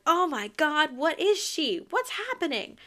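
high, loud mock panic; hammed-up shocked reaction; alarmed disbelief. Acted reaction to a monster transformation.

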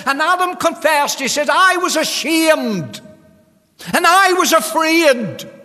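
high, loud, sustained pulpit cry; full-force preaching. Real, not acted. Preacher tells congregation of Adam hiding, full-force preaching.

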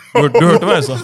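loud laughing roar. Real, not acted. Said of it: "Han brøler". (brølende, med latter)

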